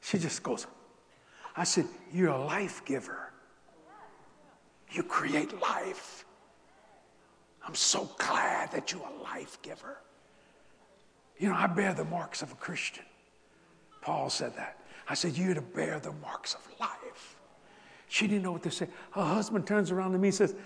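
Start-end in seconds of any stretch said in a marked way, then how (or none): 2.1-10.0 slow, soft and hushed, almost a whisper; tender affirmation. Retold or imitated. Preacher softly telling a self-conscious mother on the beach, hushed and tender. 15.4-17.3 hushed. Retold or imitated. Hushed blessing to the mother.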